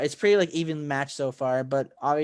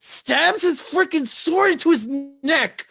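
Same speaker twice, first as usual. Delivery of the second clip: high, loud, climactic recap; excited retelling; 8 semitones higher, 6 dB louder than usual. Real, not acted. Fan retells the sword strike at sunrise, high and excited. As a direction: build the suspense with punched, raised words.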